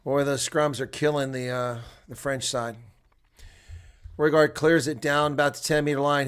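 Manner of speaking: ordinary talk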